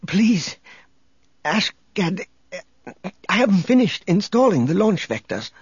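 high, thin desperate plea; weak pleading. A narrator's reading. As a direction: beg feebly for mercy. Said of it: "a weak noise of protest".

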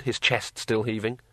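ordinary delivery, nothing marked

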